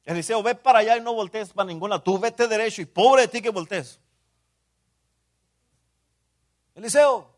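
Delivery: hammed-up reprimand, calling the name out loud; playing the scolding mentor for the congregation; stern scolding. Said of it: "A veces me regaña, me dice".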